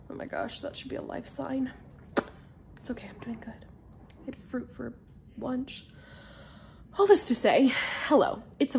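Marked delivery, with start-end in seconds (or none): 0.1-5.9 soft whispered aside. Real, not acted. Telling viewers she is doing fine, in a whisper. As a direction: drop to a whisper as if sharing a secret.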